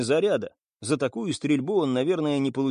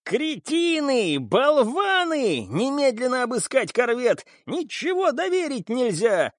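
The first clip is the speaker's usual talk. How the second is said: high, furious screaming boss; furious shouting; 10 semitones higher, slower than usual. A narrator's reading. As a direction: scream insults at useless men. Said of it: "Кирби продолжал кричать и угрожать".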